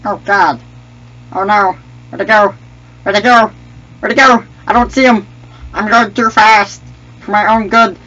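high, loud, playful alarm; frantic game panic. Pretend. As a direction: cry out in mock panic. High excited playing, Jar Jar mentioned later.

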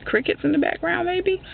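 high-pitched teasing question; high joking aside. Acted. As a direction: toss it off in a high voice. Joking about his silence.

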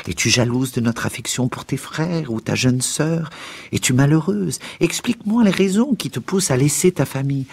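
increasingly high-pitched, urgent questioning; anxious appeal climbing in pitch; pleading father. A narrator's reading. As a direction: plead with your child for an answer. Father pleading with his daughter.

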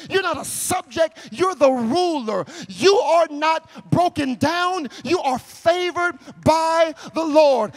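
shouted pulpit crescendo; full-force preaching. Acted.

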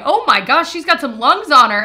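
voicing wowed nurses, loud and high; amazed nurses. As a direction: exclaim it like an impressed nurse.

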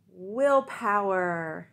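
willpower stretched slow and loud; drawn-out stress. Acted. Frog stresses willpower to Toad, slow and loud. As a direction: lean hard on willpower.

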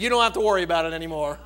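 rushed, fast punchline delivery; quick punchline. Retold or imitated. Little boy confessing the broken dish, quick punchline. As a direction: tumble the words out quickly. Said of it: "He said".

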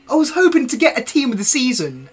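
loud, high, fast complaint; furious rant. Real, not acted. Streamer yelling at the game over a goalkeeper, loud and high. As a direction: shout your outrage at the screen. Ranting at pack contents.

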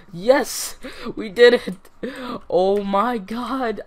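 high, loud victory whoops; triumphant cheering. Real, not acted. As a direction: celebrate surviving. Survived, back home.

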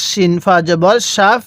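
normal talking voice

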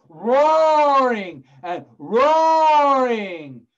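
loud, high, very drawn-out words; roared-out narration. A narrator's reading. Storyteller tells of the trapped lion, roaring the words. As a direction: turn each word into a long roar. Narrator imitates the lion's roar.

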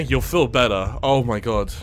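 ordinary talk

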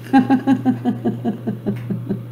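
an airy, high demonstration laugh; demo laugh. Shown as a demonstration. Host laughs to get viewers laughing. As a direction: laugh first so they join in. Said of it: "No, they haven't".

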